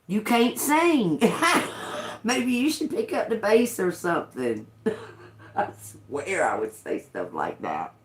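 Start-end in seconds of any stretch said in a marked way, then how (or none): 0.1-4.7 teasing taunt. Retold or imitated. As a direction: needle him with playful scorn. Teasing the bandmate.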